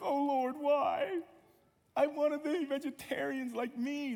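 high, hammed-up tearful lament; mock crying. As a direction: wail a prayer in mock despair. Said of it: "go to my room and cry".

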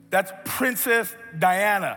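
"Princess Diana" slow and loud, word by word; emphatic spelling-out. Retold or imitated. Friend to clueless boy, "Princess Diana" slow and emphatic. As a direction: stretch "Princess Diana" out high, as obvious.

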